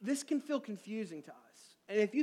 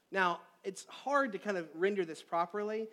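ordinary talk

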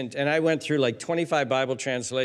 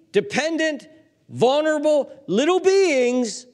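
higher, louder list building to a loud peak; rising passion; 9 semitones higher, 4 dB louder, slower than usual. Real, not acted. Preacher building passion over the helpless children. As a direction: pile up each word with growing fervor.

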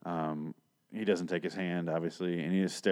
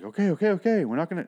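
higher, jittery okays to calm a gunman; nervous placating; 9 semitones higher, 3 dB louder, faster than usual. Retold or imitated. Kids at gunpoint in the barn, higher and nervous. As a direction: back off fast, hands up, scared. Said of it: "a tense moment".

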